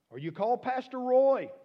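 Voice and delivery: loud, high preacher's call; high shouted aside. Real, not acted. Preacher shouting to the church to call their pastor. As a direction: cry it out over the crowd.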